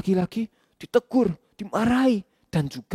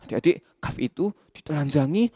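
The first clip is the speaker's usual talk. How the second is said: plain reading voice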